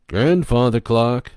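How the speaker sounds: a hint of a drawn-out TV host call; children's show host call. Captain Kangaroo calling to the clock puppet, slow and loud.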